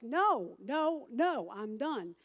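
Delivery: loud, emphatic no, no, no; firm refusal. Retold or imitated. Sponsor finally refusing needy sponsees, loud and firm. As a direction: shut them down hard, with finality.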